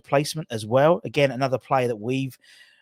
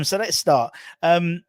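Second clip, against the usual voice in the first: ordinary talk, nothing marked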